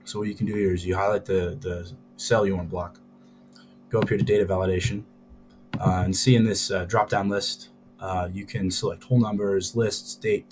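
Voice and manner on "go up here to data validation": usual speaking voice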